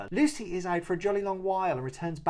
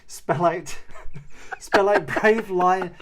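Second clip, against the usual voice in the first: laughing; 4 semitones higher, 8 dB louder, much slower than usual. Real, not acted. Laughing while reading summary.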